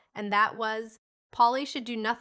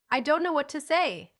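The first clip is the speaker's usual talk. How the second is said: flustered stammer; 6 semitones higher, 6 dB louder than usual. A narrator's reading. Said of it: "stammered Missus Pepper".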